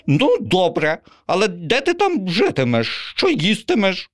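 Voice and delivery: sceptical pigeon. Acted. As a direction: poke holes in her plan. Pigeon asks.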